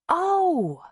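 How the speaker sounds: high, drawn-out exclamation of surprise. A narrator's reading. Woman realizing her glasses are off, exclaiming. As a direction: exclaim it, pitch leaping up. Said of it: "I exclaimed".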